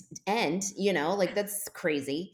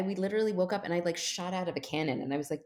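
ordinary delivery, nothing marked

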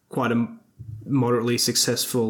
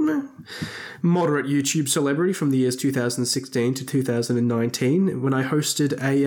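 ordinary talk, nothing marked

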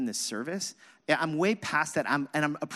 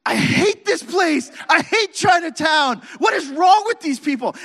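furious yelling; 13 semitones higher, 11 dB louder than usual. Retold or imitated. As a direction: rage at the traffic at the top of your lungs. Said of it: "yelling and screaming".

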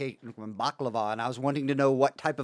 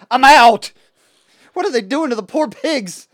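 loud; 10 semitones higher, 13 dB louder than usual. Pretend. Joking outrage about squealing pigs.

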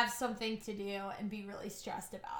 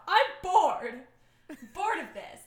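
mock bored whine; 5 semitones higher, 8 dB louder than usual. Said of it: "I tend to say".

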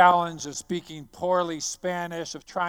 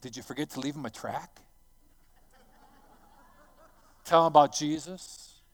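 sarcastic jab. Retold or imitated. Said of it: "he added on some sarcasm to me".

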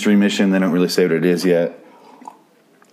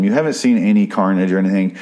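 normal talking voice

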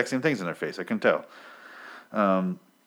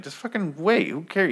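higher, exasperated fan groaning at preseason hype; impatient mock rant; 10 semitones higher than usual. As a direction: wave it off, fed up. Impatient fan scolding sports talk.

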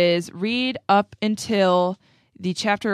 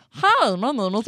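put-on Cartman-ish kid voice; 4 semitones higher, 3 dB louder than usual. Shown as a demonstration. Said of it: "how you start your Gollum voice".

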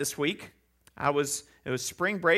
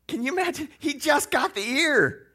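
amused disbelief; 9 semitones higher, 4 dB louder than usual. Real, not acted. Mocking Peter's aim.

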